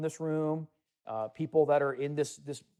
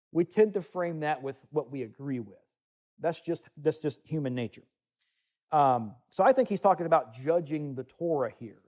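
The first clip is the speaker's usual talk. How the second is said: ordinary talk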